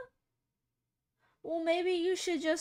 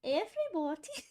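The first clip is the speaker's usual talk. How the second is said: posh British woman; 4 semitones higher than usual. Retold or imitated. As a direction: stretch it out like a prim British lady. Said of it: "If I keep saying that".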